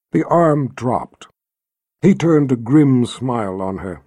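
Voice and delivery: usual speaking voice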